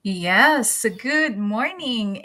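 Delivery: ordinary talk, nothing marked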